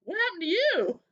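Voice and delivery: high, loud astonished gasp of a question; amazed coworkers. Retold or imitated. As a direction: play the stunned colleagues. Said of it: "they're gonna be like".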